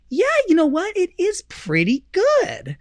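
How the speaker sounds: a grudging concession; mock wife.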